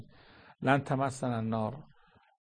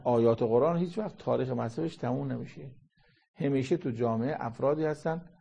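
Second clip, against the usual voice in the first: ordinary talk, nothing marked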